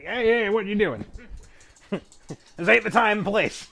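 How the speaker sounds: high, loud offended protest; indignant thug. Acted. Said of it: "he's gonna be like".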